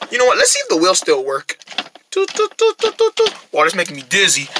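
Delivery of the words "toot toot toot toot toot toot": high-pitched little toots; toy horn toots. Pretend. Rabbit testing the toy car, playful tooting. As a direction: toot like a tiny car horn.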